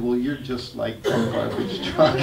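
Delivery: amused, laughing through it; laughing punchline. Retold or imitated. Teacher teasing the child, laughing. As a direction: deliver the punchline with a chuckle.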